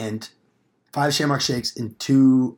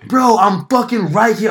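slow, loud, high holler; exasperated shout to someone out of earshot; re-enacted yell, drawn out; retold yelling; 8 semitones higher, 8 dB louder, slower than usual. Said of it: "I'm, like, yelling at him".

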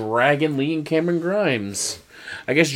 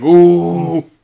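mock taunting ooh; 4 semitones higher, 8 dB louder than usual. Logan Paul taunting.

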